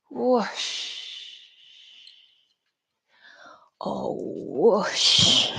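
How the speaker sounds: drawn-out rushing sh; breathy whoosh. Shown as a demonstration. Yoga teacher leading a forward swing, whooshing. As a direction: let each whoosh sweep out with the breath. Imitates rush of exhaled breath.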